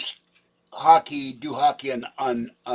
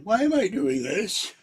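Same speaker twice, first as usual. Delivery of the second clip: slow, louder, higher mock complaint; exasperated groan; 10 semitones higher, 9 dB louder than usual. Tennis player fed up in the heat, exasperated. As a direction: whine it like you've had enough. Retelling sweaty tennis misery.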